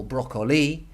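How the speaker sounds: "broccoli" stressed on the last syllable, brocco-LEE; "broccoli" as brocco-LEE, deliberately misplaced stress; wrong end stress. Shown as a demonstration. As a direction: land hard on the final LI of "broccoli". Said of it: "is not gonna be".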